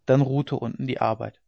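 usual speaking voice

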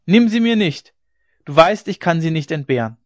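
loud high cry to God; desperate cry. A narrator's reading. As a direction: shout to heaven in despair. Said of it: "schrie er".

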